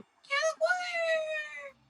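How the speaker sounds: very high, drawn-out shriek in a strange voice; panicked yell. Acted. Fleeing creature yelling his own name in high panic. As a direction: wail the name while fleeing. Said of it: "running around yelling".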